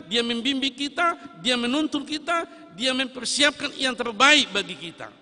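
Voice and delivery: high, loud, hammering each clause; full-force preaching litany. Acted. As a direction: pound out the list like a drumbeat.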